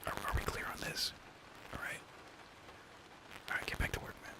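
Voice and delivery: hushed secretive whisper. Acted. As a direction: whisper it so nobody overhears. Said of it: "I have to whisper this one".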